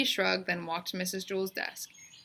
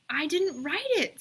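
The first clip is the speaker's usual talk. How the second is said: put-on higher, slow and firm, a young girl insisting; girl's insistent voice; 9 semitones higher, 3 dB louder than usual. A narrator's reading. Bebe insisting to Calvin she did her homework, higher and slow. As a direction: draw out the yes like a stubborn kid.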